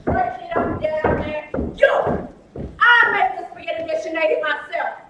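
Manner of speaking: loud scolding call; yelling down. Acted. Wife scolding the men from upstairs, loud. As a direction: yell it down the stairs. Woman yelling at poker players.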